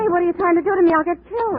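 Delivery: high, loud, frightened protest; alarmed outcry. Acted. Janie to the detective who set her up, frightened outcry. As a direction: cry out in fear at the plan. She learns she's the bait.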